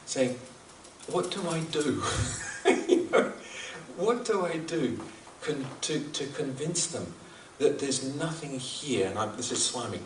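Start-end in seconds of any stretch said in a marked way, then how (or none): 1.1-9.1 mild, helpless frustration with a small laugh; frustrated. Retold or imitated. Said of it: "you could hear the frustration in his voice".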